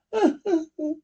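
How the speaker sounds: a high broken sob. Acted. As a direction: break into a sob. John breaks down.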